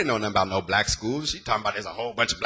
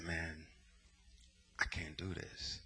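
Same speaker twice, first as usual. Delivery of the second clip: hushed confession; 5 semitones lower, 18 dB quieter, slower than usual. Retold or imitated. Confessing to a dangerous friend.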